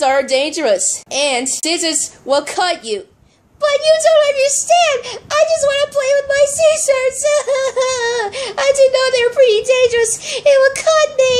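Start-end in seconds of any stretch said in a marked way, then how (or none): 3.6-10.1 high-pitched doll whining through mock sobs; tearful protest. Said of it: "don't cry". 10.4-11.3 loud, high-pitched tearful doll voice; pretend crying. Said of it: "don't cry".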